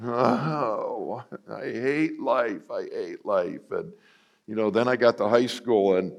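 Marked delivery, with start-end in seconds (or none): 0.0-1.2 put-on a sigh of teen gloom; half-hammed despair; mock moping. 1.5-2.7 slow, higher drawn-out whine; hammed-up misery; mock moping. Moping teen. 2.7-3.7 deflated repeat; mock moping, fading. Moping teen.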